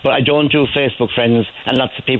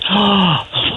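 mock gasp; 8 semitones higher than usual. Woman's surprise retold.

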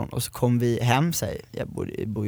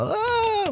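high shriek, much higher; imitated mom's shriek; 5 dB quieter than usual. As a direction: shriek like a panicked mother. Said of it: "börjar hon också bara".

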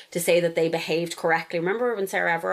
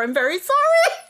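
high, louder pleading apology; sheepish, pitched-up sorry; embarrassed apology; 5 dB louder than usual. Real, not acted. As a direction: apologise with a rising squeal. Mock-embarrassed apology to listener.

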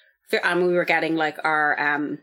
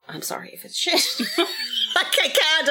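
sheepish laugh; 10 semitones higher than usual. Real, not acted. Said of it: "sheepish apology".

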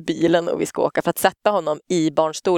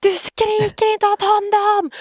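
high, drawn-out imitated scream; retold yelling back; 14 semitones higher, 3 dB louder, slower than usual. Scared parent turns on Ola over the child, imitated shriek. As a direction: re-enact screaming back at a partner. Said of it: "Så jag bara vänder mig och bara".

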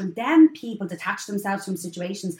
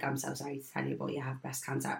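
much softer, lower delivery of bad news; quiet grave news; 7 semitones lower, 14 dB quieter than usual. Surgeon delivering the diagnosis, hushed and grave. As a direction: break it gently and gravely. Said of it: "Sat down in front of him".

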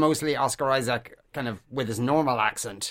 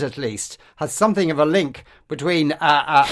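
ordinary talk

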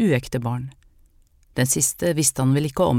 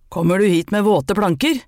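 angry man; 3 semitones higher, 5 dB louder than usual. Acted. Angry man confronts wet woman.

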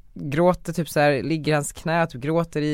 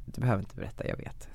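much softer, a gentle mother's knowing reply; a quietly voiced mother, tender and calm; 5 semitones lower, 10 dB quieter than usual. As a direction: tell him tenderly that you already knew. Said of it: "Och mamma säger typ så här".

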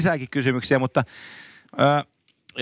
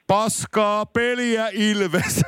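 slow, drawn-out higher mock yell; imitated heckle; 5 semitones higher, much slower than usual. Imitating the old fan at Ilves games, drawn-out mock shout. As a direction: stretch it out like a lone heckler's call. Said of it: "niin sen ainoa huuto".

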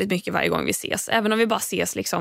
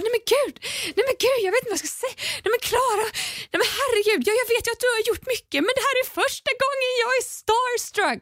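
very high, rushed babbling in disbelief; breathless high starstruck rambling; starstruck neighbour; much faster than usual. Retold or imitated. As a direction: gush it out fast like a stunned fan. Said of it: "hans hand framför munnen bara darrar".

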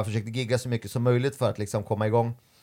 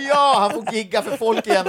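high, loud jubilant cry; exaggerated celebration; overjoyed; 10 semitones higher, 5 dB louder than usual. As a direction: mimic someone thrilled to bits. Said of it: "blir så jävla glad".

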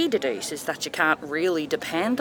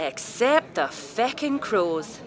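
slow deliberate punchline; much slower than usual. Acted. Repeated punchline, slowed.

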